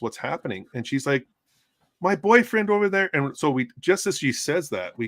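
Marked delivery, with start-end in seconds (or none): 2.0-3.1 high, urgent voice of the trapped woman; panicked woman. Retold or imitated. Cuffed woman telling medics about boyfriend, high urgent voice. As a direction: point him out in a frantic high voice.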